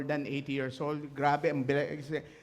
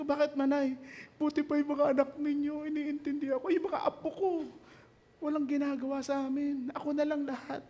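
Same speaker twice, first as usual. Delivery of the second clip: put-on high, plaintive voice of a weeping old woman; quoting the old woman's complaint, pitched high; lamenting old woman; 8 semitones higher than usual. Old woman crying to the pastor about her grandchildren, high and plaintive.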